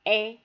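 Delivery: E sounded as an open "è". Shown as a demonstration. Teacher asking which syllable holds it, open è. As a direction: say "E" as è, mouth open. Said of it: "le son ouvert E".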